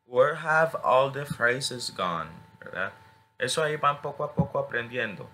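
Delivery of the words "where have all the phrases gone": whole sentence very slow and deliberate; slow careful repeat. Shown as a demonstration. Teacher models the phrase for the class very slowly. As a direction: drag every word out evenly. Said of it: "la escuchan y la repiten".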